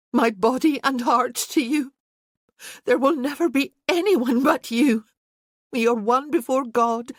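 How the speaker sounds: put-on heartfelt vow from a young bride; Isabel's vows. A narrator's reading. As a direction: pledge yourself to a dying man. Isabel speaks her vows.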